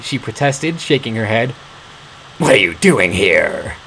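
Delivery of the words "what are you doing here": low, slow beastly growl; a monster's voice filling the dungeon; growling boom. A narrator's reading. Castle master growling at the intruder, low and slow. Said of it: "growling voice boomed".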